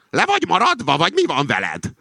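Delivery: high-pitched scolding question; indignant. A narrator's reading. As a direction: take someone to task, voice pitched high. Acted quarrel over the misquoted slogan. (magas hangú, felháborodott számonkérés)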